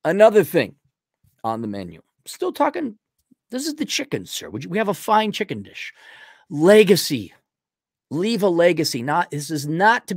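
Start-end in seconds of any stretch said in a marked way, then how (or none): 2.2-5.9 mock waiter. Mock waiter presenting menu.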